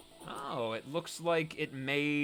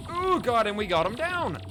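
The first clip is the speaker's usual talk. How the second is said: loud, high victory cry; much higher and louder, thrilled and relieved; real triumphant relief; 7 semitones higher, 7 dB louder than usual. Real, not acted. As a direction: burst out as the boss finally drops. Boss killed in the game.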